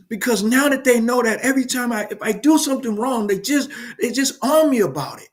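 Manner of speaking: put-on a kid fed up with the teachers; upset young daughter. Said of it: "and she says".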